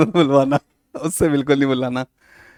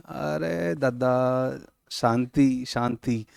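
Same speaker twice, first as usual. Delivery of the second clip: softer, slow hushing plea for calm; mock calming; 4 semitones lower, 11 dB quieter, much slower than usual. Teacher hushes a rowdy student, soft and slow. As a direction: settle him down, drawn out and soft.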